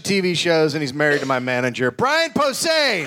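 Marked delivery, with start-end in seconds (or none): 1.9-3.0 slow, high, shouted name; announcer intro. Acted. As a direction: stretch the name out for the crowd. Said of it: "Ladies and gentlemen".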